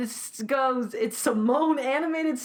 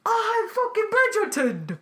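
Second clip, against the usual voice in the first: angry Cockney rant; 9 semitones higher than usual. Retold or imitated. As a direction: spit it out like an angry Cockney actor. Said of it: "He's, like, Cockney when he's mad".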